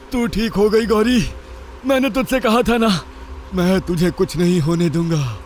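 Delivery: a father's joyful relief; relieved father. Acted. Father relieved at revived daughter. (राहत भरी पिता की आवाज़)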